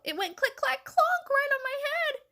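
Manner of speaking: high, rising chick voice; panted alarm. A narrator's reading. Chick telling the hen about the bump, panting. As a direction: blurt it out high and excited. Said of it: "panted Chicken Little".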